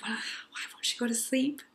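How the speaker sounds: soft, half-whispered helpless thought; whispered exasperation. Retold or imitated. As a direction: whisper it to yourself, at a loss.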